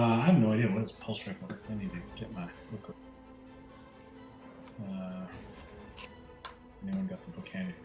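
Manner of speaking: normal talking voice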